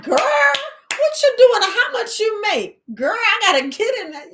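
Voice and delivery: high, loud mock of nosy relatives. Mocking relatives who pry about her pay, high and eager. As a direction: ham up the eager gossip.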